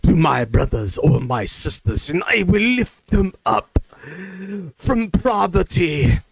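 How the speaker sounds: slow, grand mock oratory, rising in pitch; declaimed pledge. Reading out a pledge he was asked to share, declaimed. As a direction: declaim it like a solemn oath, drawn out.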